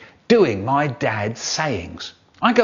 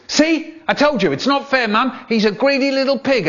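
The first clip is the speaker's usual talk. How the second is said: whiny little brother; 11 semitones higher than usual. Acted. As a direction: whine it to Mum like a wronged kid. Brother tattling to Mum.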